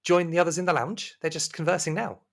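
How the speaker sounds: high, courteous hostess voice; high-pitched, attentive servant; polite serving woman. Acted. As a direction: invite the guest in, pitched well up. Plays Rosemary in the game.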